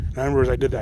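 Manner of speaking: ordinary talk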